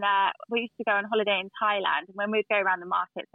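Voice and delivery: normal talking voice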